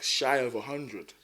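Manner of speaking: normal talking voice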